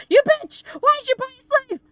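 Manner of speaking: high-pitched mock outrage, loud; playful fake fury at the streamer; mock outraged yell. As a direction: shriek it in hammed-up horror. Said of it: "Fenris is like".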